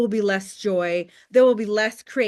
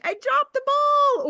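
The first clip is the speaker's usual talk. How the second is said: mock whiny voice, much higher; guilty whine. Seller owning up to neglecting customers, in a mock high whine. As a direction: squeak it out like a guilty little kid.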